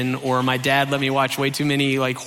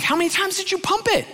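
very high, rushed squeak; hammed-up high-pitched alarm; alarmed questioning; faster than usual. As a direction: demand it in a panicky squeal. Acted alarmed questioning of brother.

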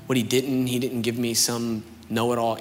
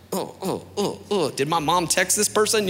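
rising, high-pitched startled oh-ohs; mock alarm; 7 semitones higher than usual. Churchgoer feeling the sermon targets him, comically alarmed. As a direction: act caught out, pitched up.

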